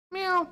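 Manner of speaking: very high squeaky mew; cat meow. Acted. Cat appears in scene.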